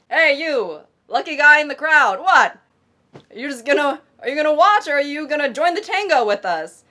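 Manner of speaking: loud, high calling across a crowd; a bold mock shout to the voyeur. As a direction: hail the lucky guy from afar. Acted call-out to stranger.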